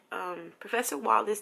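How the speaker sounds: normal talking voice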